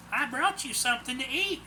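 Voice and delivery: put-on high, sweet old-lady voice. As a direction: offer food sweetly, like a granny. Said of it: "she said sweetly".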